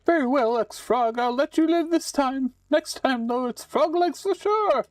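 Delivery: high, loud cackling witch casting a spell. Acted. Witch cursing the player into a frog, high and gleeful.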